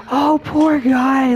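high, slow, breathy-free sympathetic coo; imitating Claire's concern. Streamer mimics Claire pitying the dead man, high and slow. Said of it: "I love how she goes".

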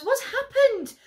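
loud, high panicked call; alarmed shout. Acted. Parents rush to screaming daughter.

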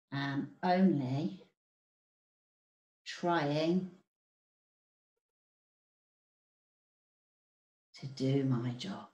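soft, slow begging, a touch of desperation; desperate plea. Acted. Teacher voicing the man pleading with the jaguar, soft and desperate. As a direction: plead quietly and slowly, lightly voiced. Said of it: "he's gonna be pretty desperate".

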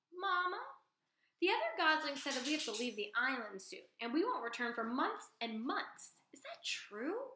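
small gosling asking his mother; young gosling. Acted. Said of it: "said Bright Bill".

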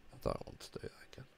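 quick breathy whisper, fast. Pretend. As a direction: hiss it out fast under the breath. Repeats line whispered.

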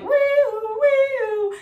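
high sung woo-woo siren; a wailing mock siren; imitated alarm siren. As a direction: sing it like a store alarm. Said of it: "the alarm sounds like".

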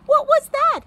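high, loud, startled question; excited, high-pitched surprise. A narrator's reading. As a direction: react with sudden surprise to a sound. Startled reaction to a distant call.

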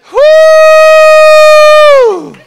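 very high, very loud woo; joyful scream. Real, not acted. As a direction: let out a joyous scream. Joy breaking out.

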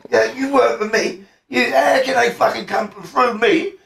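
high, incredulous protest; indignant retelling. Retold or imitated. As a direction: act out an outraged boss. Acted quarrel, insisting on his point.

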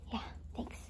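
soft whisper to a pet. Real, not acted. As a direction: whisper thanks so as not to startle. Hushed thanks to the cat.

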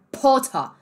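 Potter with an explosive P, open ah ending; spat-out P. Retold or imitated. Teacher imitating film boy, spitting the P. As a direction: spit out the P in Potter. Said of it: "Ele faz um P, tá cuspindo".

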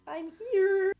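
high, loud singsong taunt; sadistic grin. A narrator's reading. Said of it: "hear his sadistic grin through his voice".

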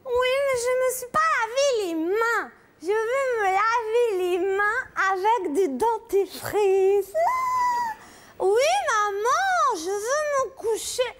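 put-on high whimpering little girl; whiny child. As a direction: whimper and plead like a tired kid. Child whimpering, calling mother. (voix d'enfant pleurnicharde)

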